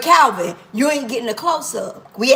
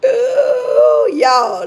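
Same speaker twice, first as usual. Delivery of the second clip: high, loud delighted squeal; excited squeal; 3 semitones higher, 7 dB louder than usual. Real, not acted. Squealing to her live viewers about the food. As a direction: shriek with joy at the food.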